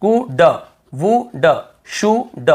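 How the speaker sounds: could, would, should with the L left out; teacher demonstrating could, would, should without the L sound; silent L. Shown as a demonstration. Scene: teacher drilling students on silent L, careful demo. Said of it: "केवल".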